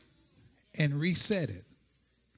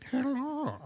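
fake church voice; 5 semitones higher, 4 dB quieter than usual. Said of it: "Why are you talking funny?".

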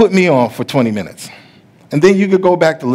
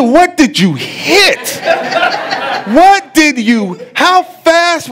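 high, slow, laughing shock at the damage; half-laughing, half-alarmed dad; laughing disbelief; 13 semitones higher, 4 dB louder, much slower than usual. Retold or imitated. Dad asking daughter what she hit, laughing in disbelief. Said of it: "You know, I'm laughing".

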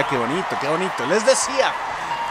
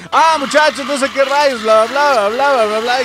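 higher, louder blabbering; mock loud chatter; 6 semitones higher, 5 dB louder than usual. Shown as a demonstration. Streamer showing talking would spin the cart, loud blabber. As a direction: babble on loudly at raised pitch. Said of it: "si estuviera hablando estaría así como de".

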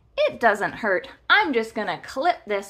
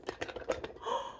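soft gasp of wonder; 13 dB quieter than usual. Pretend. Watching the alien light up.

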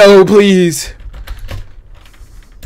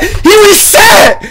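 excited shout; 12 semitones higher, slower than usual. Real, not acted. Opponent resets during fight.

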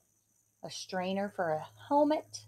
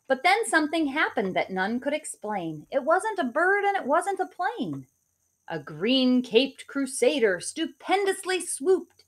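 put-on lively rhyming read-aloud for kids; story narration; 3 semitones higher, 7 dB louder than usual. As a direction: read it like a bedtime picture book. Reads picture book aloud.

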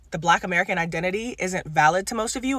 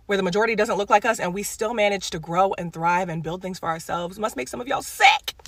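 ordinary talk

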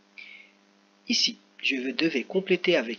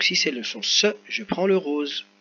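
usual speaking voice

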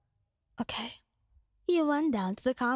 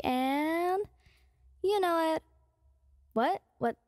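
ordinary delivery, nothing marked

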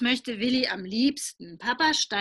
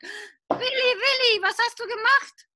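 much higher, loud startled calls; alarmed father; 12 semitones higher, 4 dB louder than usual. Acted. Father looks up from paper, calls out alarmed and high. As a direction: cry out the name in shock.

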